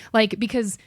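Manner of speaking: usual speaking voice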